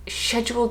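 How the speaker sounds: schedule as SHED-jool; soft sh at the start of "schedule"; posh schedule. Shown as a demonstration. Vlogger feeling pretentious, posh schedule. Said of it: "like, very posh".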